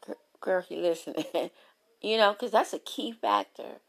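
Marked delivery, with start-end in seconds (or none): none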